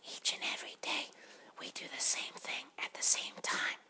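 soft breathy whisper, no voice; three little butterflies whispering their claim. A narrator's reading. Butterflies telling Grasshopper to move, in a soft whisper.

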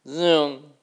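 loud high zooming whoosh. Acted. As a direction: shout zoom like a speeding dragonfly. Dragonfly whoosh sound effect.

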